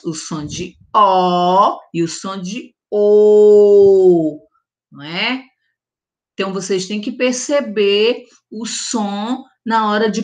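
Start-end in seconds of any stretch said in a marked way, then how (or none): 0.0-4.4 slow "o som de O e o som de O", one closed O then one open O; two O sounds. Shown as a demonstration. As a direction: contrast the two O's slowly. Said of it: "os dois sons".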